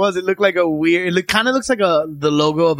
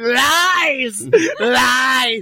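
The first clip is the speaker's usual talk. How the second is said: high, hammed-up shout of accusation; mock protest cry; 9 semitones higher than usual. Imagining him standing in the park protesting, mock outraged cry. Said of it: "standing in the middle, just going".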